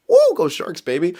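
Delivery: a sudden high whoop for the Sharks; mock cheer. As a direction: cheer it like a fan, tongue in cheek. Jokey cheer for rival team.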